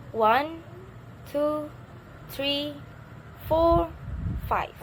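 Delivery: each number held high and level, "five" falling; counting intonation. Shown as a demonstration. Teacher demonstrating how to count, high steps then falling "five". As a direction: separate the numbers with pauses, drop "five". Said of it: "in the word five".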